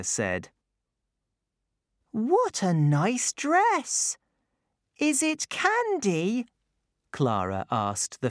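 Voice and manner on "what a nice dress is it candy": put-on high, bright child voice; little girl voice. A narrator's reading. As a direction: speak as young Clara. Clara speaks.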